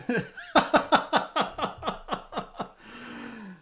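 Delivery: high, rolling laughing fit; long laugh. Real, not acted. Streamer tickled by his own wife impression, long high laugh. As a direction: keep laughing helplessly in high bursts.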